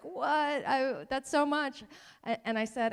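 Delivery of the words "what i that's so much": loud, drawn-out overwhelmed protest; exasperated parent voice, played up; fixed-mindset parent. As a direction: balk loudly at a huge task, higher and slower. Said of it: "my fixed mindset parenting voice, um, sounds like this".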